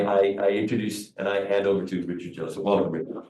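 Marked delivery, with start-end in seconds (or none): none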